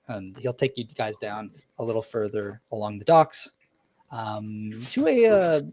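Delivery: ordinary talk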